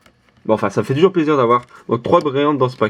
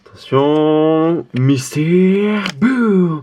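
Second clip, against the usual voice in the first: dramatic announcer; 5 semitones higher, much slower than usual. Pretend. Dramatic card reveal announcement.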